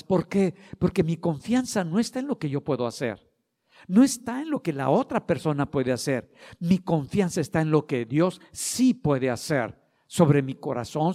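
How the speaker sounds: loud, fervent sermon build; preaching at full force. Acted. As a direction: preach it out, ringing and insistent. (predicación con toda la fuerza)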